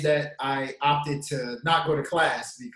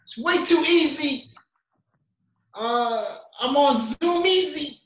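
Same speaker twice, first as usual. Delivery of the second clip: hype intro; 9 semitones higher, 4 dB louder, slower than usual. Acted. Hyped intro rhyme.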